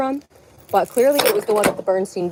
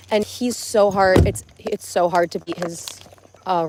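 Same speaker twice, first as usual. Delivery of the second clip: ordinary talk, nothing marked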